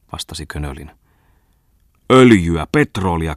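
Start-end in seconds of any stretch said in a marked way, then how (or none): 2.1-3.4 loud roar that drops off. A narrator's reading. Bungman roars at Könölin about the fuel, loud bellow. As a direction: bellow the two words. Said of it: "karjui Bungman".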